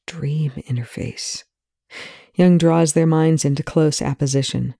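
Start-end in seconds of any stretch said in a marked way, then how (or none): 0.1-1.4 slow breathy whisper. A narrator's reading. Disoriented Rush naming the dream interface, slow whisper. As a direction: whisper it slowly, half lost.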